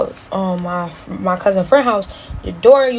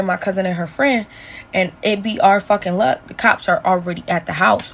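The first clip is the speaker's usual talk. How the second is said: ordinary delivery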